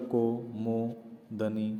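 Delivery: कोमोदनी said slowly, vowels stretched; wrong form read out. Shown as a demonstration. Teacher reading the misspelled word, slowly. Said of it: "तो वो लिख देगा".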